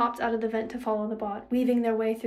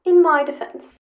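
loud, higher robot protest; robot character, voice raised; robot companion; 6 semitones higher, 13 dB louder than usual. A narrator's reading. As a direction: plead your case loudly as a robot. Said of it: "Seven said".

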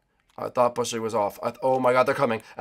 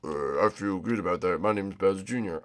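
lightly voiced game character, lower pitch; Bowser Junior; 4 semitones lower, 5 dB quieter than usual. Player role-plays his character replying, lightly put-on low voice. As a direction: answer as the racer's character, low and subdued. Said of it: "no more fantasy role play".

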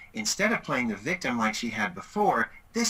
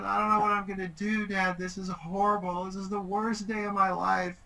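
higher, whiny mock-tearful teen voice; a dad imitating his daughter's tearful wail; imitating crying daughter; 7 semitones higher than usual. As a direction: play the heartbroken teenager, half-hammed. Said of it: "she started crying".

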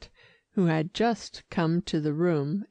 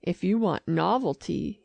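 plain reading voice

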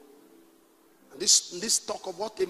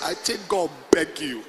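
lower, plain-paced pidgin line; Warri pidgin; 5 semitones lower than usual. Shown as a demonstration. Preacher repeats the Warri pidgin verse. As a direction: say it as a Warri local would. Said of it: "Warri English".

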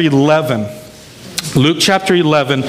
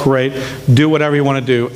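ordinary delivery

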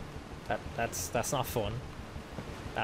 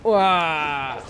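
loud, higher "wah" cry; startled yelp; 4 semitones higher, 8 dB louder than usual. Real, not acted. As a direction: yelp in alarm at a sudden hazard. Startled by hazard in game.

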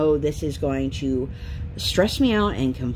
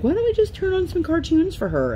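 high-pitched mocking dad; 13 semitones higher than usual. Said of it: "my dad will be like".

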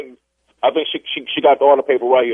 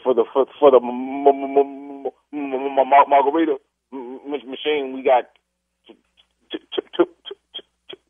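stuck on M, then on voiceless T; severe stutter. Real, not acted. Customer answers about the margarita machines, stuttering badly. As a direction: labour through long blocks until each word finally comes out. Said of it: "damn, you're, you're, you're w-, w-, worse than mine".

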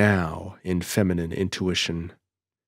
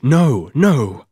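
louder, higher pleading no no; urgent refusal; 6 semitones higher, 7 dB louder than usual. A narrator's reading. Gloria refuses to walk down the beach, urgent. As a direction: stop him at once.

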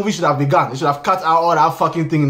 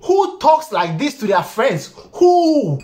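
incredulous disbelief; 7 semitones higher, much slower than usual. Real, not acted. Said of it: "reviewer mocking the dialogue".

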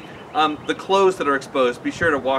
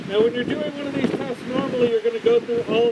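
speaking behind filter mask; through a respirator mask; 4 semitones higher, 3 dB quieter than usual. Real, not acted. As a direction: mouth covered by respirator. Seen 5: Half-face respirator with pink filters worn over mouth throughout the words.

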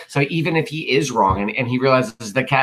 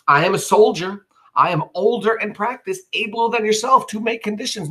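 boastful Cassius; 9 semitones higher than usual. Acted. Cassius boasting to Brutus, high indignant brag. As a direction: puff up and brag like an indignant old soldier.

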